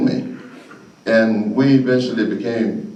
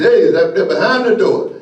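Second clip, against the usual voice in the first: louder, higher retold call; dad calling out; 9 semitones higher, 6 dB louder than usual. Dad spotting his son behind the door, calling out. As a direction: call out like a dad catching a kid. Retelling dad catching him hiding.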